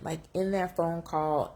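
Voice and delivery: ordinary talk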